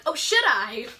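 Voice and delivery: coy retold comeback with a rising lift; playful, flirty question; flirtatious. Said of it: "You have a flirtatious personality".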